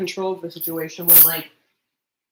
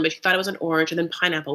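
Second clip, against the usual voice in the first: ordinary talk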